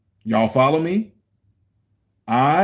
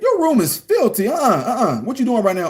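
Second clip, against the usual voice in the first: scolding dad; 6 semitones higher than usual. Retold or imitated. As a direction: scold the kid's messy room. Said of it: "I said".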